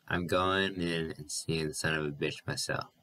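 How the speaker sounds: ordinary delivery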